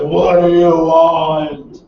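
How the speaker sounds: slow, deep monster voice. Acted. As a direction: drag it out low like a monster behind a door. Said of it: "the monster behind the door says".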